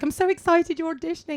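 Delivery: high, quick gushing quote; imitating an eager well-wisher; imitated excitement. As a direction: gush like a keen friend. Voicing her enthusiastic friend.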